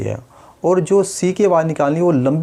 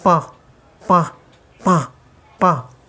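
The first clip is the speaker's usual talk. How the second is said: पा with air leaking out through the nose; nasal leak; 4 semitones higher, slower than usual. Shown as a demonstration. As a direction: let पा sound blocked and nasal. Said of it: "उनकी sound इस तरह निकले". (नाक से निकलती हुई पा की आवाज़)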